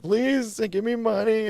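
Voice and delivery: high pleading whine; whiny begging. Retold or imitated. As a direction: beg like a desperate addict. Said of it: "they would always hear crying, manipulation".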